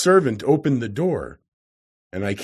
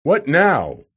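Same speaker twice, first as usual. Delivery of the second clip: loud, higher roaring demand; raised, roaring challenge; 5 semitones higher, 6 dB louder than usual. A narrator's reading. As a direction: roar it impatiently. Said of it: "he said in a roaring voice".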